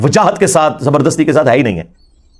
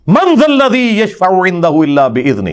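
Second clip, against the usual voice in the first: slow, drawn-out recitation of a verse; Quran recitation; slower than usual. Acted. Preacher quotes the verse on intercession to listeners, slow recitation. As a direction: recite it slowly, stretching the syllables.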